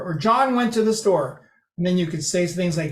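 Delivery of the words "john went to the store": John raised and stressed, falling through store. Shown as a demonstration. Lecturer showing it was that person, stress on John. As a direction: punch John, let the rest drop. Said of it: "That person went to the store".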